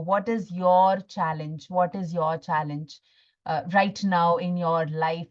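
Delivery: ordinary talk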